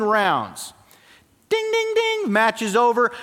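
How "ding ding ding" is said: high ringing bell sound; imitated boxing bell. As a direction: ring it like the bell.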